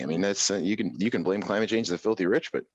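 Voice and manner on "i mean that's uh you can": ordinary delivery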